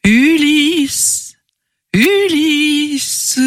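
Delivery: high eerie luring call; siren call. Acted. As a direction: call him like a sea siren. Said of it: "s'élèvent des voix suaves".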